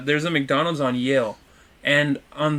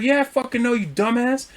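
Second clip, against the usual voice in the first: raised-pitch defensive comeback; retold angry retort; 8 semitones higher than usual. Driver insulting the truck driver, retold irritably. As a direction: act out snapping back, not shouted.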